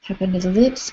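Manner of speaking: usual speaking voice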